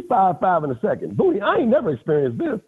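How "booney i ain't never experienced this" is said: much higher, astonished telling; high-pitched incredulous amazement; amazed. Real, not acted. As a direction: sound like you've never seen such a thing. Astonished at the game.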